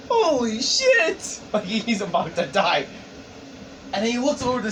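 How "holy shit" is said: high, drawn-out mock astonishment; stunned shock. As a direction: stretch it out, high and aghast. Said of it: "looking at this guy like".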